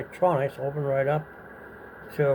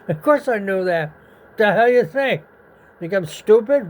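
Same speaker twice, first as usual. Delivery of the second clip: higher and louder, a cocky scoff; mock indignant retort; 5 semitones higher, 6 dB louder than usual. Teenager scoffing at the inspectors, in mock indignation. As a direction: scoff at them like the answer is obvious.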